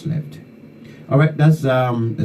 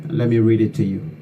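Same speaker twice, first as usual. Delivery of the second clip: ordinary talk, nothing marked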